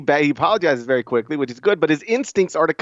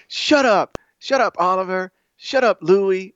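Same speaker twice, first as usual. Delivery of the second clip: put-on bickering kids; 6 semitones higher, slower than usual. Voicing his bickering kids.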